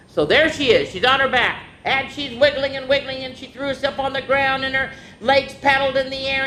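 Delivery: plain reading voice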